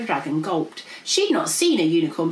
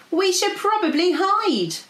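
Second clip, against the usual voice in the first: alarmed yell; 12 semitones higher, 4 dB louder than usual. A narrator's reading. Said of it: "yelled Princess P".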